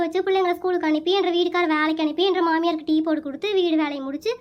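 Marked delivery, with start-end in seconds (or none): none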